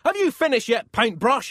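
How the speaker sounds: high, loud impatient call; angry shout. Acted. Angry Pope banging on the chapel door, shouting. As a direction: shout up at the painter. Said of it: "he shouted".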